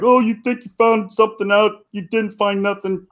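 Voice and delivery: high put-on jeering voice; mocking sceptic. Imagined viewers doubting what he found, high mocking jeer.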